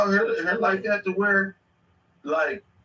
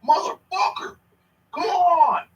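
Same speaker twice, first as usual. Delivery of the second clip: mock yell; 10 semitones higher, 4 dB louder than usual.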